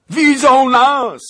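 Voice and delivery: very high, drawn-out roaring shout. A narrator's reading. Nobleman to companions as Tatars spot them, panicked roar. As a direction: bellow the alarm at full volume. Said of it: "ryknął Zagloba".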